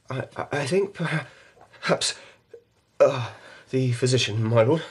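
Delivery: high, halting, tripping over each word; panicked stammer, voice climbing; frightened, stumbling words. Acted. Panicked lieutenant seeks help.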